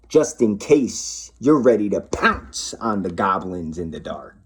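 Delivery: gentle bedtime storytelling; reading to children. A narrator's reading. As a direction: read it like a bedtime book.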